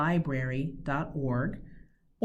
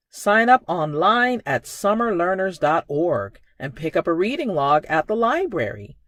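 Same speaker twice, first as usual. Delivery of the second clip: upbeat promo read; 2 semitones higher, 9 dB louder than usual. Acted. Library promo announcement.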